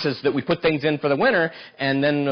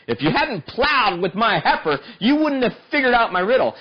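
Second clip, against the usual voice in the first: taunting riddle; 9 semitones higher than usual. Retold or imitated. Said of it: "the way he riddled it back to them".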